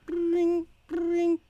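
high trilled ringing; high-pitched steady ring noise; imitated phone ring. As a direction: voice an old telephone's ring.

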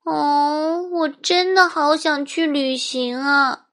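put-on high, dreamy child voice. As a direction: sigh it to yourself. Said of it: "自言自语地说道".

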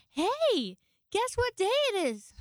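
high, loud eager teasing; excited coworker. Retold or imitated. Coworker greeting the tired podcaster, excited. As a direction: sing it out like a kid.

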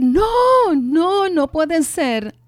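alarido agudo y fuerte, lento al final; grito desgarrado. Retold or imitated. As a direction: gritar no con dolor de madre. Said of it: "yo empiezo a gritar". (alarido de madre en dolor)

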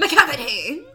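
loud, showy villain announcing himself; Macavity's entrance cry. Retold or imitated. Imitating Macavity's grand entrance in a retelling, loud cry. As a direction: burst out with the name, big and loud. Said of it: "That's how he comes out!".

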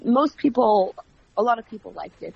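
ordinary talk, nothing marked

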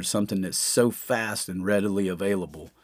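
usual speaking voice

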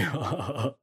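a cocky chuckle; gorilla chuckle. Acted. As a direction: laugh off the complaint. Gorilla laughs.

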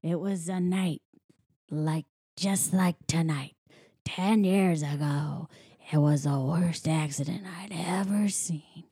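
low, slow, hushed ghost-story telling; older woman's spooky tale. Retold or imitated. Trucker telling of the worst accident, hushed and ominous. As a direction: draw it out in an eerie near-whisper. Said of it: "it's this older woman".

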